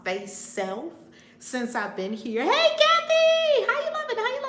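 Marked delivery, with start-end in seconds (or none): 2.4-4.4 very high squealed hello; excited greeting. Real, not acted. Host spotting a viewer joining, squealed greeting. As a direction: shriek the name, then sing-song hellos.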